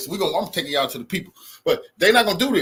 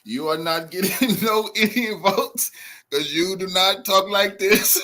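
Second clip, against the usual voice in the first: slow, lightly put-on Indian accent; a laughing, half-hearted Indian accent impression; mock Indian English, slowed down; imitating an Indian accent; slower than usual. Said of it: "my boy say".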